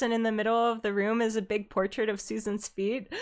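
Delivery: usual speaking voice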